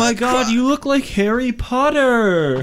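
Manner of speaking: high, awed voice; high gushing. Acted. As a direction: gush like a starstruck fan. Leland gushes at Harry Potter.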